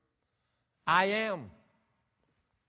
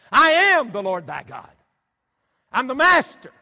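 much higher and louder, slow and majestic; voice of God; 9 semitones higher, 16 dB louder than usual. Acted. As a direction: declare it as the Almighty himself.

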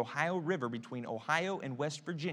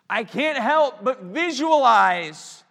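much higher and louder, drawn out; slow loud emphatic repeat; 7 semitones higher, 11 dB louder, slower than usual. Shown as a demonstration. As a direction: preach it out big the second time.

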